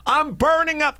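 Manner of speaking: high complaining yell; heated family shouting. Retold or imitated. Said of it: "She's like".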